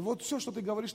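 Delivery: ordinary talk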